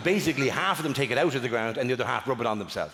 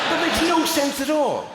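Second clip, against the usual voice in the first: mock outrage; 11 semitones higher, 3 dB louder, slower than usual. Comedian rants at audience member.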